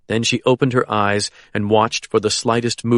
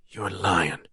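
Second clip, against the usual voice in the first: soft, hushed and spooked; spooked whisper; 3 semitones lower, 9 dB quieter than usual. A narrator's reading. Frightened captor whispers his doubt to the woman. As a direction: whisper it, unnerved. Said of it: "a spooked whisper said".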